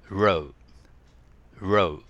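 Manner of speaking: row said twice with a rounded R; R quiz word. Shown as a demonstration. Teacher quizzing students on L or R. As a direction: give the R sound for guessing. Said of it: "Rだったのか当ててください".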